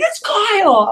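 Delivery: high, imitated outburst; exasperated exclamation. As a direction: blurt it out as an exasperated caller. Retold caller exasperatedly naming himself.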